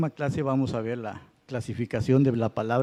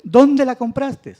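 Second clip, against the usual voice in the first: "dónde" punched high and loud; emphatic accent on DÓNDE; 8 semitones higher, 7 dB louder, slower than usual. Shown as a demonstration. Teacher shows class the second example, loud high "dónde". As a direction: hit "dónde" hard and high, then fall. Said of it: "Ahí está el acento enfático".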